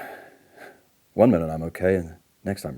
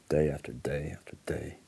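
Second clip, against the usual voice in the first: whispered, worn out and hopeless; hushed weary repetition; despairing whisper; 8 dB quieter than usual. Acted. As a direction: breathe it out as if defeated. Despairing aside.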